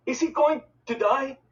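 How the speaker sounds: high, frightened stammer; a boy terrified for his brother. A narrator's reading. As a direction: force the words out in fear. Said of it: "I stammered".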